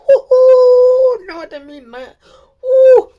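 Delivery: hammed-up loud wail of dismay, high; mock wail. Fan hamming up regret over using her first name.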